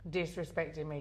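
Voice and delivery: ordinary talk, nothing marked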